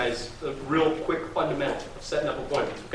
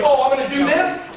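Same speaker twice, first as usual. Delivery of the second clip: higher-pitched, eager mock promise; 9 semitones higher, 4 dB louder than usual. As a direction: act out someone keenly volunteering. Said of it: "if you tell somebody".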